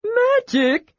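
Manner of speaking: very high, delighted question; thrilled. A narrator's reading. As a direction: squeal it with delight. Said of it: "Tass was thrilled".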